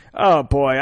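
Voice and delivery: normal talking voice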